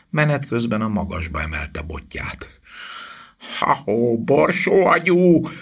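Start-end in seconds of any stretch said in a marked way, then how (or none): 3.6-5.5 high, loud drawn-out taunt; long, high jeering call; hollering. A narrator's reading. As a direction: holler mockingly to draw attention. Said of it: "rikkantotta Ron".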